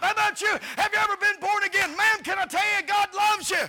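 high, loud street-preacher cry; fired-up preaching. Retold or imitated. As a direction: belt it at her like a street preacher. Preacher confronting a woman on street.

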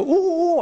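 high, drawn-out chimp hooting; chimpanzee hoot. Retold or imitated. Preacher guessing how chimpanzees signal, mock chimp hoots. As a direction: imitate a chimp calling out. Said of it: "I don't know if they go".

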